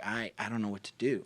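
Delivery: lightly voiced downcast farmer, at a loss; sad farmer. Storyteller as the farmer over his trapped donkey, mildly sad. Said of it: "and he's sad".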